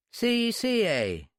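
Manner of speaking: high, slow Irish echo. A narrator's reading. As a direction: mull it over in an Irish accent. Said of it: "as Irish as a sprig of shamrock".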